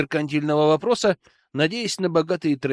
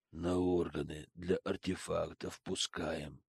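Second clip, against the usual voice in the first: much softer, flat, casual horror; calm matter-of-fact; 10 semitones lower, 14 dB quieter than usual. Acted. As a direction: mention it as if trivial. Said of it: "каким спокойным, будничным тоном".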